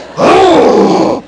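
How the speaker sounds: loud, high strained gasp of effort; effort heave. Acted. Trainer acting out the crushing blow, strained heave. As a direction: heave the piano down on him.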